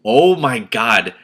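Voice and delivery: slow, loud exclamation; real dismay. Real, not acted. Reader reacting to the bleak story, slow dismayed cry. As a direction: groan at how bleak it gets. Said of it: "Why is this getting so bleak".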